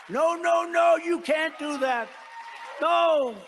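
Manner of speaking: high, loud, slow mock panic as Macron; hammed-up Frenchman begging no; a hammed-up cry of no, no, no as Macron; panicked protest. Macron panicking at tariff threat.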